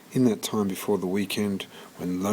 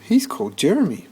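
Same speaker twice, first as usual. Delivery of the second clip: shelter lady, relenting; 9 semitones higher, 5 dB louder, faster than usual. Acted. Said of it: "said the woman".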